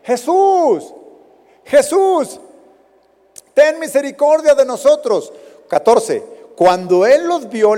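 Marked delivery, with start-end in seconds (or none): 0.0-5.3 Jesús pitched high and called out, pleading; desperate cry for mercy. Retold or imitated. Lepers calling to Jesus from a distance, high pleading cry. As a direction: cry out to him from far off. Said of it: "le gritan".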